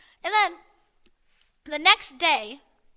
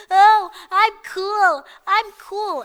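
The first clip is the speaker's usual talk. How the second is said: higher, drawn-out mocking boast; hammed-up dumb kid voice; mock cool kid; 4 semitones higher, 6 dB louder, much slower than usual. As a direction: imitate a crybaby insisting. Mocks the kid claiming he's cool.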